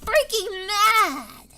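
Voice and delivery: slow, choked, shouting mad; half-crying shout of anger; frustrated rant. Real, not acted. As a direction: let real frustration crack through. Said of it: "and I'm so pissed".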